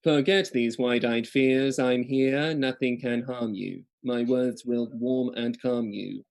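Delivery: slow, even voice-assistant read; Siri voice. Shown as a demonstration. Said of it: "next Siri voice".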